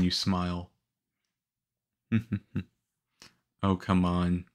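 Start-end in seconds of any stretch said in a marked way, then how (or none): 2.1-2.6 soft chuckle. Acted. Teasing the rookie about smiling, soft chuckle. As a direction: chuckle lightly under the breath.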